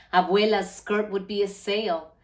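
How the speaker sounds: ordinary delivery, nothing marked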